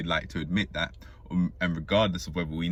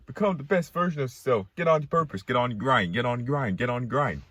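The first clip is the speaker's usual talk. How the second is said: higher, preachy mock motivational voice; mocking hype guru; 5 semitones higher than usual. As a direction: parrot the grind gurus, pitched up. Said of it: "These guys out here talk about".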